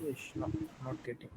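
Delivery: faint, unvoiced mumble; almost inaudible whisper; barely audible. Real, not acted. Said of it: "your voice is very low".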